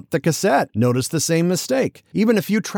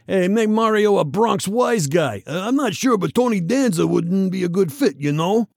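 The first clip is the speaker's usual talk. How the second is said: Bronx wise guy; 5 semitones higher than usual. Retold or imitated. Fans pitching a Bronx Mario, mock Bronx accent. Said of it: "Some fans are saying".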